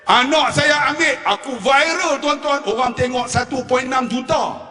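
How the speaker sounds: ordinary talk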